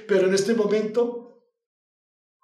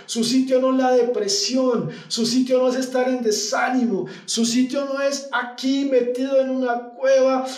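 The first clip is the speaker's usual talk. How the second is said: higher, pressing voice with a shout on mijo; urgent divine call; 5 semitones higher than usual. Acted. God rousing Elijah in the cave, urgent and raised. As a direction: shake him out of his fear. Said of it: "Dios diciéndole".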